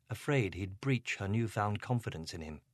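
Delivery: ordinary delivery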